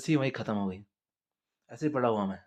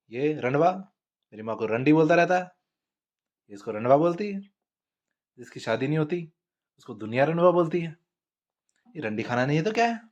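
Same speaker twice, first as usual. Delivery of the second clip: loud argumentative comeback; loud back-and-forth with the brother; heated retort; 4 semitones higher, 7 dB louder than usual. Retold or imitated. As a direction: shout him down mid-quarrel.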